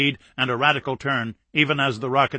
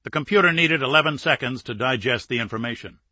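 ordinary delivery

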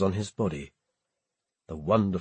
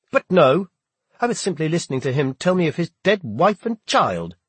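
higher, loud angry reply; heated retort; 7 semitones higher, 5 dB louder than usual. A narrator's reading. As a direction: fire it back at him. Heated quarrel with captain.

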